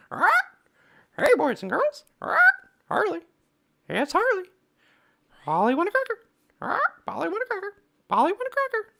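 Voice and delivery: loud, high squawking parrot. Shown as a demonstration. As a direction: squawk it like a talking pet parrot. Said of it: "where I got my parrot voice from".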